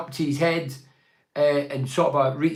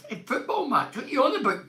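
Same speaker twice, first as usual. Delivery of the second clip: higher, scoffing disbelief; incredulous; 10 semitones higher than usual. Real, not acted. Radio host mocking a letter to football fans, scoffing disbelief. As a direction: ask it as if it's absurd.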